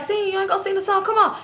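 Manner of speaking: high, excited coaxing to sing along; hyped urging. Retold or imitated. Boyfriend urging her to sing [name] in the car: high hyped coaxing. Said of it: "So he like".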